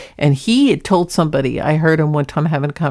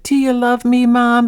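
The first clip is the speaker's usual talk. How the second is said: high put-on cat voice, a bit louder; imitating the cat; 6 semitones higher, 3 dB louder than usual. Cat owner replaying her cat's question, high mock cat voice. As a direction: pitch it up like a pet pleading. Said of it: "And then he just said".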